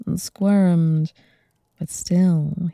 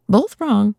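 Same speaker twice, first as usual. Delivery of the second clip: louder burst of relief; relieved cry; 5 semitones higher, 8 dB louder than usual. Acted. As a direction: shout it as the danger passes. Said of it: "cried Bilbo, sounding very much relieved".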